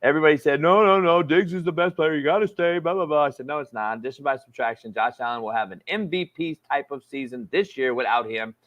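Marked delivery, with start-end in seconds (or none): none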